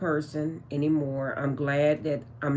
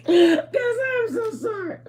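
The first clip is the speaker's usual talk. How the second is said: very high, slow squeal through laughter; laughing apology; 4 dB louder, slower than usual. Real, not acted. Vlogger apologising to viewers, helplessly laughing. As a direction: squeeze out an apology while cracking up.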